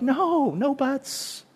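soft, drawn-out pleading protest; mock dismay. Preacher as romantics hearing Boaz say but, in mock dismay. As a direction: plead slowly like a let-down romantic. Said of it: "and we're like".

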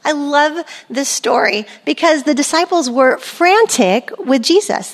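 ordinary talk

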